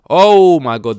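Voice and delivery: high, booming "oh my god"; loud exclamation. Real, not acted. Fan reacting to the race, loud exclamation. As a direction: burst out with it, drawn up high.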